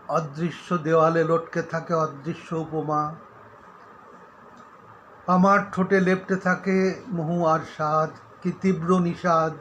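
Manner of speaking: solemn, projected poetry reading; poem recitation. Acted. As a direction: recite the poem firmly, phrase by phrase. Recites own Bengali poem.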